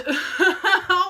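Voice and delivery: short loud nervous burst of laughter; a rueful loud laugh. Real, not acted. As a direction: laugh off the bad news. Laughs at bad news.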